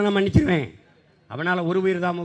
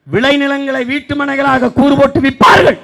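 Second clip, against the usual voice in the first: high, loud declaiming; loud prophecy; 8 semitones higher, 9 dB louder than usual. Retold or imitated. Orator quoting a sage's prophecy, high loud declaiming. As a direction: proclaim it like a prophet.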